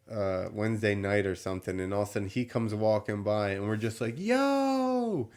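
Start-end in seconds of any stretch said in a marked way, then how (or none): 4.2-5.3 excited yell of greeting; calling out yo. Retold or imitated. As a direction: shout his name out happily. Calling out to the kid.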